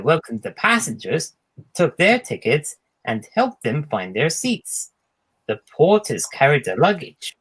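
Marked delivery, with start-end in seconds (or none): none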